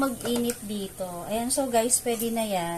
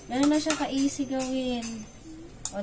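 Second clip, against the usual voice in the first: ordinary talk, nothing marked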